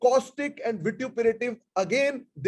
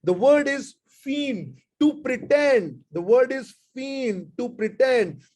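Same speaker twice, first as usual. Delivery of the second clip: slow dictation; 3 semitones higher, much slower than usual. Shown as a demonstration. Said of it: "F E I G N E D".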